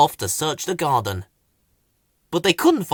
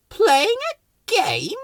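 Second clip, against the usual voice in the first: much higher, slow disdain; haughty Rose; slower than usual. Acted. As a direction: drawl it grandly. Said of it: "asked Rose".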